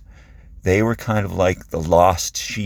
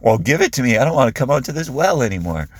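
higher, louder, mock exasperation; sarcastic; 7 semitones higher, 4 dB louder, faster than usual. Woman at the well answering Jesus, sarcastic. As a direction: toss it back with sarcasm. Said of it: "if she's being sarcastic".

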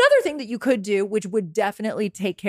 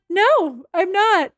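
simple no; 10 semitones higher, much slower than usual. Acted. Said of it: "it's just that simple".

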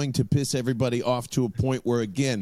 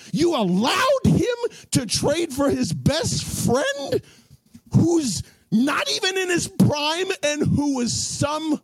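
heated shout; 12 semitones higher, slower than usual. Real, not acted. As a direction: yell it at the rival fans. Ranting at Giants fans.

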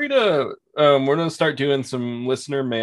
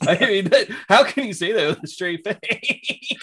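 laughing; 9 semitones higher than usual. Real, not acted. Laughing at friend's denial.

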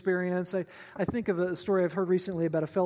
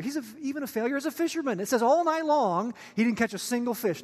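ordinary talk, nothing marked

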